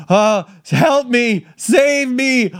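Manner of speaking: high, slow cry for help; desperate plea. Retold or imitated. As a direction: plead desperately for rescue.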